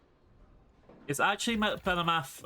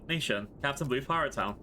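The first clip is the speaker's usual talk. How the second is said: ordinary delivery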